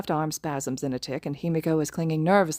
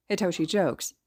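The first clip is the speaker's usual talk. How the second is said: usual speaking voice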